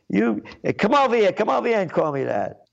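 high, mocking voice of a heckler; heckler's taunt. The heckler daring Celente to come over, high mocking jeer. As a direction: pitch it way up, jeering him to come over.

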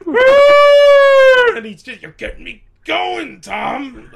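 loud high shout; yelling back. Retold or imitated. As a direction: holler it back out the window. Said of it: "He'd be like".

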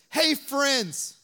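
high, warm-hearted loud hail; calling out. Retold or imitated. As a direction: call them friends across the water. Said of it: "He says".

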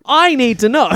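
"I" punched high in protest; mock-indignant stress on "I"; insistent retort. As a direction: hit "I" hard, high-pitched and indignant. Acted argument, audience insists back.

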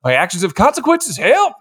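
high, loud hammed-up panic rising to a squeal on help; mock panic. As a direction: play a sudden comic alarm. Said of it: "goes like".

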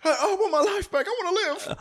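high, loud, laughing plea; George pleading for his life. Retold or imitated. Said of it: "he goes back to the bridge and he's like".

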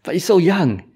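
high, loud shocked scolding from upset parents; angry parents. Acted. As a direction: raise the pitch and volume like a furious parent. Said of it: "And the parents got really angry".

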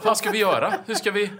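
what do we do now; mock panic. Asking Micke in a panic how to help.

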